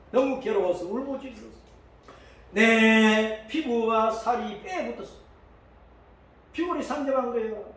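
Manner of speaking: ordinary talk, nothing marked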